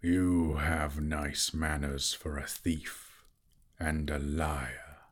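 slow deep mocking dragon. A narrator's reading. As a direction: drawl the insult slowly. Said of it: "said the dragon".

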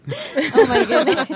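surprised laugh. Real, not acted. Reacting to pig squeal.

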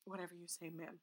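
soft mock deference; meek submission. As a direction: murmur like a cowed servant. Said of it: "and I'm like".

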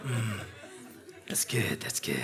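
soft, low mm yes, fading to a whisper; savoring murmur. Retold or imitated. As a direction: murmur approval like tasting soup. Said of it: "You're like".

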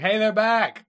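high, loud excited call; excited announcement. Acted. Co-host acting out the character spotting them, excited shout.

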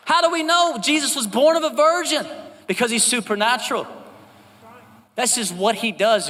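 high, loud, ringing preacher's cry; full force preaching. Acted. Pastor preaching to the congregation, loud and soaring. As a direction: preach it out to the whole room.

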